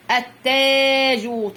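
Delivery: teacher's slow, drawn-out التاج; long madd. Shown as a demonstration. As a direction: stretch the vowel after the doubled ت in التاج. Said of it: "طبعًا ده مد الألف".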